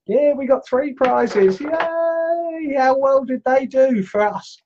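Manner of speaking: high-pitched hurrah, drawn out; over-the-top excited cheer; playful cheering. Pretend. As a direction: celebrate in a high, squealing voice. Celebrating the three prizes.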